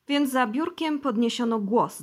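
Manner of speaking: usual speaking voice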